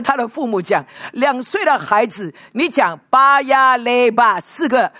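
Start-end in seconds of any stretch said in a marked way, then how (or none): 3.1-4.4 巴呀嘞吧 drawn out slowly as one whole four-syllable word; said all in one go. Shown as a demonstration. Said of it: "他念不到".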